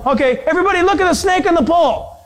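high, quick announcement; calling out. Acted. Moses telling all the people, calling out.

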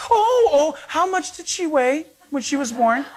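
high, cooing imitation of women passengers; mock gushing over a newborn, starting high. Women passengers asking after a newborn, cooing.

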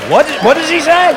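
high-pitched bewilderment; baffled viewer. Acted. Viewer failing to understand the TV, loudly baffled. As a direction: ask in loud, high confusion.

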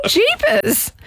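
high squeaky jeepers; playful high-pitched gasp of a word; mock shock. Real, not acted. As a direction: yelp it in fake alarm. Mock shock at racist joke.

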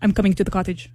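put-on Russian accent. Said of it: "the only Russian I can think of".

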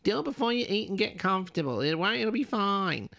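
terrible mum impression. Speaker mimicking mum telling them to go home, mum impression. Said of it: "Sorry, Mum, that was a terrible impression".

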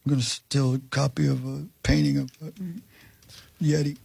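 badly faked movie-star voice, quiet and hesitant; Nicolas Cage. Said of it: "Maybe your worst impression".

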